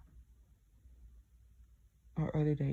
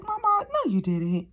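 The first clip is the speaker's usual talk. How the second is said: much higher and louder, a mom in disbelief; mock shocked mom; 9 semitones higher, 7 dB louder, slower than usual. Mother reacting to the apartment news in high disbelief. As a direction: play mom gasping at the news.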